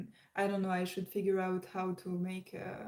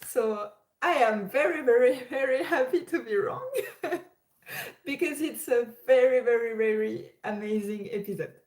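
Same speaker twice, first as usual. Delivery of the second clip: overjoyed; 3 semitones higher, 7 dB louder than usual. Real, not acted. Thrilled about the episode.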